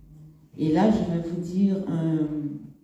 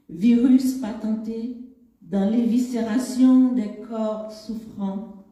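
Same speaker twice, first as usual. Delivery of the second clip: louder, higher, measured poem reading; poetry recitation; 4 semitones higher, 5 dB louder than usual. Acted. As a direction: recite it in separated phrases, with long pauses. Reads her poem aloud. (récitation de poème, voix posée et projetée)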